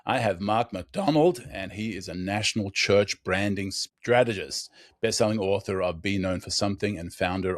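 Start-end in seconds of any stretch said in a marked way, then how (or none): none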